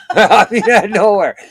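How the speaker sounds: laughing, high and loud. Real, not acted. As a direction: laugh through the words. Laughing while telling.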